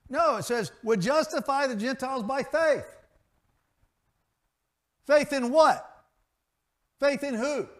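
high, loud pulpit proclamation; sermon climax, lifted and ringing; preaching at full force. Acted. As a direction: build it to a shout of praise.

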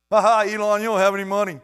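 loud, high jeering; hammed-up playground teasing; mock taunt. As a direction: taunt a rich man like a heckler. Acted taunt at a billionaire.

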